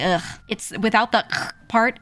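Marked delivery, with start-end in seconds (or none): 0.0-0.2 demonstrated grunt of disgust. Shown as a demonstration. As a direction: say "Ugh" as if seeing something gross. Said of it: "Imagine you see something disgusting on the ground".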